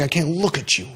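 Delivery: a quiet, hammed-up parent pushing the child away; mock disgusted parent. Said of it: "and saying".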